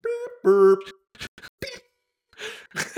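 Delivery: beeping fax machine imitation; fax machine noise. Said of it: "that's the sound of a fax machine".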